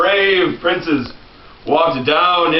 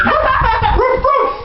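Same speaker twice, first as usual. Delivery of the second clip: much higher yappy woof; high puppy barks. Pretend. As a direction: bark like a little puppy. Dalmatian barks.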